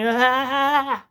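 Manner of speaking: long, very high, loud reveal cry; imitated host's whoop, held out; mock host's "ah". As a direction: run in yelling like a prank-show host. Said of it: "they always run in, like, pointing".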